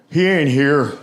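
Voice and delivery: creepy put-on reply; off-putting weirdo voice; Buffalo Bill. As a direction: make it unsettling, like a lurking oddball. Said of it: "taking on that voice like Buffalo Bill".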